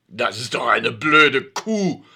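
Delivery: slow, loud, grumbled insult; grumbling curse. A narrator's reading. Alfred cursing the neighbour after she leaves. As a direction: curse her under his breath. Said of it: "schimpft Alfred".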